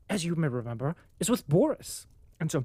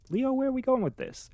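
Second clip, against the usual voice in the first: high, fast disbelieving question; incredulous; 8 semitones higher than usual. Retold or imitated. Reader questioning Tolstoy about the romance, high incredulous. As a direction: ask it in giddy disbelief. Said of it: "I was like".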